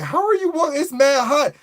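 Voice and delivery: high, baffled mock question; incredulous quote. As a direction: ask it like you can't believe what you're seeing. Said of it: "and I'm just like".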